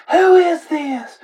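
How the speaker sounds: high, slow, loud frightened shriek of a mother; alarmed mom. Retold or imitated. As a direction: shriek it like a mother who thinks her son was kidnapped. Mom panics, thinks son kidnapped.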